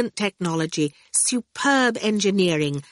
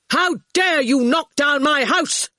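higher-pitched indignant shout; angry shout; 8 semitones higher, 4 dB louder than usual. A narrator's reading. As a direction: shout it in outrage at the intruder. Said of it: "he shouted".

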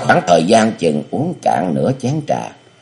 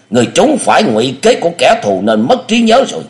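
put-on much higher, louder cunning old master; scheming elder; 10 semitones higher, 4 dB louder than usual. Địa Linh feeds the amnesiac hero a false name, high and sly. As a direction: coax the name into him, high and insistent.

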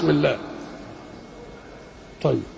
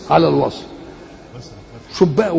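usual speaking voice

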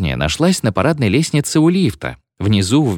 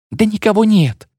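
very high, careless exclamation; bright-pitched bride's retort; dismissive protest; 13 semitones higher than usual. A narrator's reading. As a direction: brush off the warning, voice pitched up. Bride brushes off the warning.

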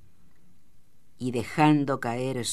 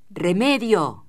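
high, loud call for the tar; shout; 6 semitones higher, 6 dB louder, slower than usual. A narrator's reading. A sheep shearer calling out for remedy, shouting. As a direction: shout it out sharply across the shed. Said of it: "gritó una voz".

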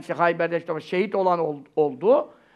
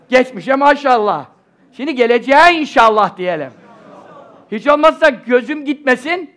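at a pulpit microphone; 4 semitones higher, 9 dB louder than usual. Real, not acted. Loud prayerful wish to audience.

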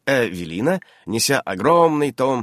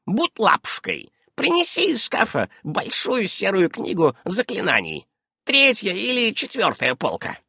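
high, coaxing witch voice; high-pitched old woman, cajoling; 11 semitones higher than usual. A narrator's reading. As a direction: sweetly send a child on an errand.

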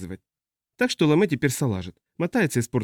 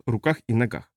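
usual speaking voice

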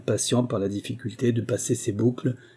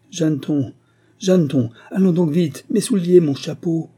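high, loud, hurried summons; urgent calling out; 6 semitones higher, 6 dB louder than usual. Acted. Curé summons Jeanneton for his shoes, urgent call. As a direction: call out impatiently for the servant.

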